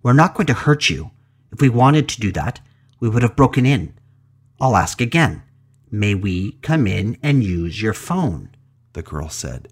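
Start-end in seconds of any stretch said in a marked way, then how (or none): 0.0-8.5 commanding, almost hostile. Acted. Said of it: "She became commanding, almost hostile".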